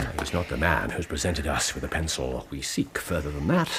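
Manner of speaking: low, hushed deduction; low murmur. Real, not acted. Holmes murmurs his deductions to Watson, low and hushed. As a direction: keep it low and private between you two.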